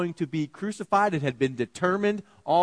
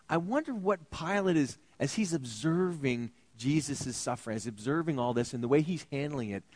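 usual speaking voice

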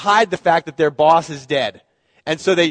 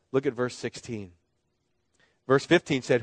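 ordinary talk

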